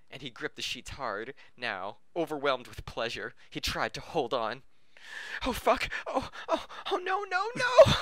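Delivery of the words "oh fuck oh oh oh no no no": higher and louder with each oh and no; rising mock climax cry. Reading the climax aloud, a hammed-up rising shriek. Reader acts character's climax.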